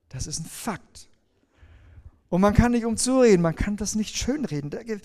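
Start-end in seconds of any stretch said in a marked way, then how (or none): 0.1-1.1 hushed whisper of conviction. Real, not acted. Preacher whispers a solemn fact to his listeners. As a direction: drop to a whisper to drive it home. Sermon point dropped to whisper.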